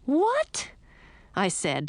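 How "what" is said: very high, startled yelp of a question; surprised. A narrator's reading. Princess startled by Frog's kiss suggestion, high surprise. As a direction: blurt it out high in astonishment. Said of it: "so surprised that I stopped crying".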